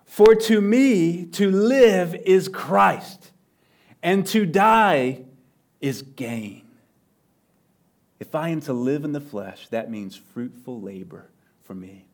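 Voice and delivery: slow, solemn scripture reading, drawn out. Shown as a demonstration. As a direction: let each phrase of the verse land. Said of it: "Listen to this".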